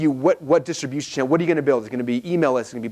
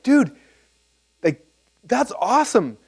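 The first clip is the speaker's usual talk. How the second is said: excited praise; 8 semitones higher, slower than usual. Retold or imitated. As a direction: gush at him, blown away. Reacting excitedly to friend's idea.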